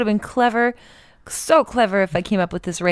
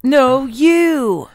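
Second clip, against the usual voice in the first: put-on childish retort; 8 semitones higher, 7 dB louder than usual. Joining the playground retort.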